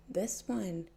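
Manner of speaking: usual speaking voice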